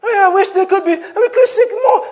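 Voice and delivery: high, whining, half-laughing complaint; mock whiny lament. Mocking the exasperated believer, a high whiny laughing lament. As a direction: whimper it in a hammed-up high voice. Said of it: "it's okay to talk about it".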